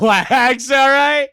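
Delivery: loud, high-pitched protest; mock defensive shout. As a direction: yell it as a joking self-defence. Defending himself against teasing.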